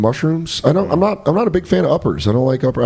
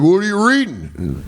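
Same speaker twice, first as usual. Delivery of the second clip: lightly mocked Southern boss voice; Southern accent; 3 semitones higher than usual. Boss catching a worker reading, mock Southern accent. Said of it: "they have, like, Southern accent".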